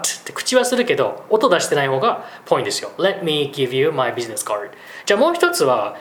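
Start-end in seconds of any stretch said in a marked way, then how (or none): 3.0-4.7 "Let" with the t barely voiced, mouth shaped but no release; "Let" — t silent, just the mouth shape; soft t in let. Shown as a demonstration. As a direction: say "Let me" with an unreleased t, slowly. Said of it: "Tはあんまり発音しない方が".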